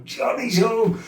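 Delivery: high menacing film-villain line; Shining quote. Retold or imitated. Likening his brother to the madman in The Shining, high menacing quote. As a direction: play the axe-wielding madman at the door. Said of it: "something out of that, um, The Shining".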